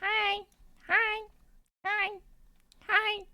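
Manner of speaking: very high, chirpy greeting; high-pitched playful hello; squeaky hi. Pretend. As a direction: squeak each hi like a cartoon. Squeaky greetings to arriving players.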